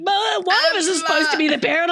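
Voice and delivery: high, quick mock-child whine; bickering six-year-old. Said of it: "two six-year-olds going".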